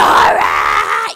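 loud, drawn-out mock roar; imitating scary mom. Daughter imitates her scary mom calling her, yelling. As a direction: bellow the name like a raging mother. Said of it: "It was so scary".

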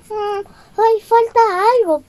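high anxious musing; worried Cinderella. Acted. Cinderella realizes something missing.

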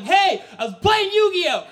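high, loud protest; frantic yell. Acted. Son explaining cards to panicking parents, frantic yell. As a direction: shout over the screaming parents.

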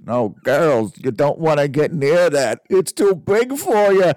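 loud, high, hammed-up New York accent; bad New Jersey accent. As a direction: imitate the worst New Jersey-New York accent, climbing into a wail. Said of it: "he's muttering in the worst New Jer-, New Jersey, New York accent".

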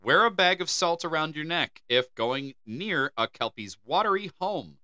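usual speaking voice

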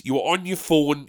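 normal talking voice

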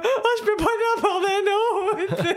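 high, loud, half-laughing panic; retelling her own freak-out, high and loud, laughing through it; panicked wail. Retold or imitated. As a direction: squeal it out like you're losing it. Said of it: "je broyais".

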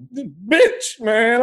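loud, high exasperated outburst; mock outrage. Son teased before his friends, loudly exasperated. Retold exasperation with mother's teasing.

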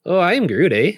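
high, loud mock Newfoundland accent; Newfie accent. Friend attempts Groot as a Newfie, hammed-up Newfoundland accent. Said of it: "like a Newfie?".

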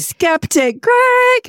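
high, slow, stretched cheer; excited shout-out. Real, not acted. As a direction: call his name out with glee. Cheering the cocktail maker.

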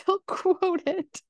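high, laughing through the words. Real, not acted. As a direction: crack up while saying it, pitch jumping up. Amused telling of kids quoting podcast.

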